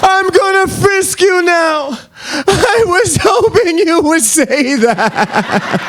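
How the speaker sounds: very high, slow stretched voice; hammed-up high voice, dragged out; high put-on voice. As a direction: draw it out in a silly high voice. Cop and speaker exchange.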